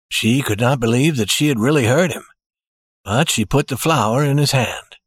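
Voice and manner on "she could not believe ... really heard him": plain reading voice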